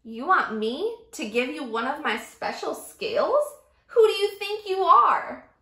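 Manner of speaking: scoff, then cry out offended; indignant outburst. Acted. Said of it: "cried the Rainbow Fish".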